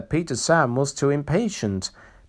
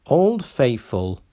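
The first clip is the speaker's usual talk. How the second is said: ordinary delivery, nothing marked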